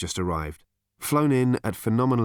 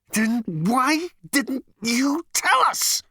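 much higher, very slow outburst; exasperated Zaphod, pitched way up; exasperated shout; 3 dB louder, much slower than usual. Acted. Zaphod scolding Marvin, high exasperated outburst.

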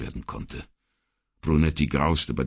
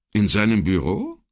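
higher, louder shocked question; appalled; 7 semitones higher, 5 dB louder than usual. A narrator's reading. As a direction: ask it aghast. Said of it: "fragte Brunetti entsetzt".

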